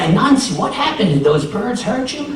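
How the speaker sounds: concerned neighbors. A narrator's reading. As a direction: ask with alarm. Neighbors worried for Anansi.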